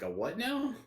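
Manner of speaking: high, rising confused question; baffled reaction. Acted. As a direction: act out a bewildered bystander. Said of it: "any normal person would be like".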